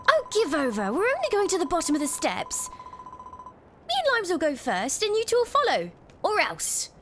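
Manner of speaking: put-on high, brisk, commanding old woman; bossy Babs voice. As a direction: boss them about, no nonsense. Voices Babs giving orders.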